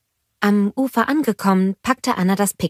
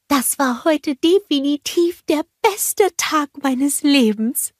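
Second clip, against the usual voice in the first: cheerful snowman Olaf; 9 semitones higher, slower than usual. A narrator's reading. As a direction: bounce up to the kids full of joy. Olaf happy at picnic.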